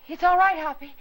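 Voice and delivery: calling out to Hoppy that all is fine. Acted. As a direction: call out loud so he hears. Calling out to the boy outside.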